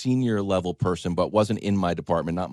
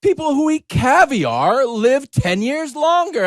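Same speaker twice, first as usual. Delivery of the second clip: mock breathless headline; mock news hype; 11 dB louder than usual. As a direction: sell the fake study like breathless news. Mocking a hyped study claim.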